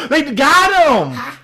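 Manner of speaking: high, slow, delighted shout; gleeful cry. Retold or imitated. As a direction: crow it out in delight. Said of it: "I was just like".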